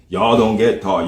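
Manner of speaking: ordinary talk, nothing marked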